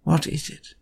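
softly, with patient understanding; soft, patient question; gentle and patient. A narrator's reading. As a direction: coax him to talk, quietly. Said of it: "patient understanding".

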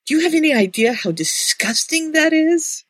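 high, appalled question; mock-appalled, pitch well up; disgusted. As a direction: complain in hammed-up revulsion. Acted complaint about being paired off.

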